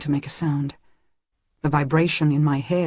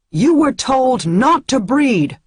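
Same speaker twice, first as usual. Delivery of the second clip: higher, louder, slow furious shout; 8 semitones higher, 7 dB louder, slower than usual. A narrator's reading. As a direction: bellow the accusation at the crowd. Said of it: "the seeker shouts".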